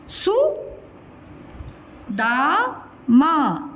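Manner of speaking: Sudama in three separated syllables. Shown as a demonstration. Teacher spelling a name for children, syllable by syllable. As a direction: sound out Sudama slowly, su, da, ma.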